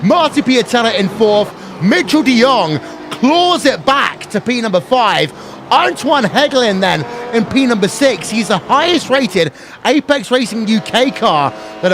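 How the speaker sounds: excited race commentary, pitch climbing for the win; live play-by-play. Acted. Race announcer to viewers on the final lap, excited play-by-play.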